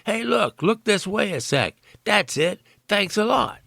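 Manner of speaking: put-on playful American accent, ordering him about; American accent, calling out behind a camera; mock American accent. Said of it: "in her version of an American accent".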